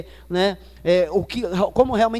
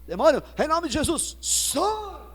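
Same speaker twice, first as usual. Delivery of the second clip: mocking a shouting believer, high pitched SAI; mock exorcist shout; 6 semitones higher, slower than usual. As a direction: ham up the crente who yells at demons. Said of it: "irmão, é assim, ó".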